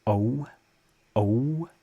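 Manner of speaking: O drawn into a gliding wave; English wavy vowel. Shown as a demonstration. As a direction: let O swell and glide like English. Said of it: "you tend to make waves with the vowels".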